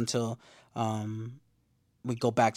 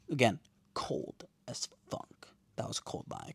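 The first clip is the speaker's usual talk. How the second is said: breath-only aside, much softer; awed whisper; 8 dB quieter than usual. Real, not acted. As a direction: whisper it in stunned admiration. Awed aside on a cold line.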